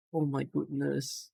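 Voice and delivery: almost under your breath, overwhelmed; a real hushed oh my goodness; hushed awe. Real, not acted. Overwhelmed reading names.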